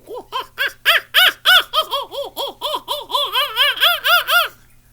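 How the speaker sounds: high, loud wild hooting cackle; mating owl call. Shown as a demonstration. Bird caller shows barred owls mating, wild cackling hoots. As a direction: whoop like barred owls in the swamp. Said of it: "or else they're mating and they'll go".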